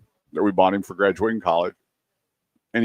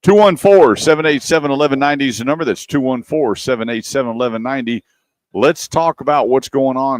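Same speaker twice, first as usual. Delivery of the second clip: loud, high ad read of the number; 6 semitones higher, 7 dB louder than usual. Acted. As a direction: sell the phone number like a radio spot. Reads dealership phone number.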